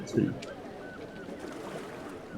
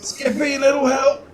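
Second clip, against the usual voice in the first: lightly raised, whiny call; mock plea for help; 13 semitones higher, 4 dB louder than usual. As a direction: beg a friend for help. Player calls for help.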